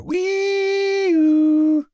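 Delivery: high, drawn-out siren wail; long high vocal siren; fire siren imitation. A narrator's reading.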